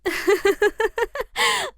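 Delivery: high, rippling giggle; laughter. Real, not acted. Guest teased about a weepy film, high laughter.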